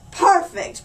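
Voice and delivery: triumphant mayor crowing; crowed. Acted. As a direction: crow in triumph. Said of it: "crowed the mayor".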